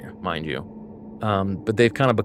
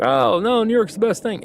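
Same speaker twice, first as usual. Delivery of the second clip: mock gushing, pitched much higher; gushing fan; 10 semitones higher than usual. A New Yorker defends the city, mock gushing. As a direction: play up a starry-eyed fan. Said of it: "people from New York like".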